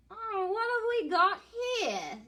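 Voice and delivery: high, curious creature voice; echidna voice. Acted. Echidna peeking into the dingo's stew, high and curious. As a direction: peer in, high and nosy. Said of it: "says the echidna".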